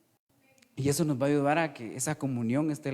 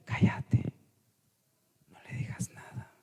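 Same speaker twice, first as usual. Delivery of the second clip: whispered command. Retold or imitated. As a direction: hush him with a gentle whisper. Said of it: "el Espíritu Santo me dice".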